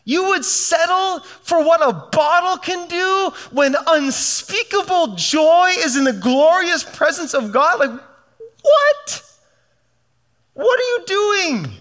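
God aghast at settling for a bottle; climbing cry of what; God incredulous. Retold or imitated. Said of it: "I think God would say".

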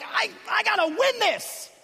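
high strained anxious plea; anxious panic. Retold or imitated. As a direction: panic about losing. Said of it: "we're like".

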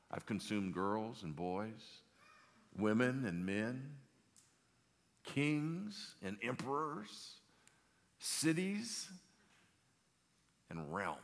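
deliberate, spaced-out listing, pitch climbing then dropping; slow ominous list. Acted. Aslan telling Jill what he has eaten, slow and deliberate. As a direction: give each item its own weight, slowly.

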